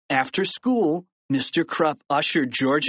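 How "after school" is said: usual speaking voice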